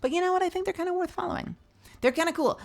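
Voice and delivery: playful high voice. Acted. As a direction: pop up into a high cute voice. Playful plug for a friend.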